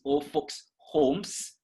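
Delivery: homes with a long hissed final S, slow; Old folks homes, end S clear; slow, hissed S endings. Shown as a demonstration. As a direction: drag out the S in homes. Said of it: "Pronounce your S".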